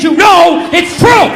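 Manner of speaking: loud, slow emphatic shout. Retold or imitated. Comic voicing the chat poster's all-caps line, loud slow shout. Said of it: "capital letters".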